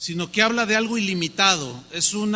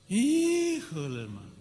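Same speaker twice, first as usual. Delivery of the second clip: amazed híjole; 6 dB quieter than usual. Acted. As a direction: stretch it out in disbelief. Imagining debt forgiven.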